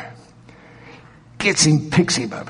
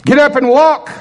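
loud, high shouted order; sudden high, forceful call; healing command; 14 semitones higher, 8 dB louder than usual. Acted. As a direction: command a lame person to rise.

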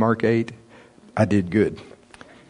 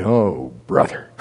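exasperated Norval; 5 semitones lower, slower than usual. Retold or imitated. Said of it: "Norval would say".

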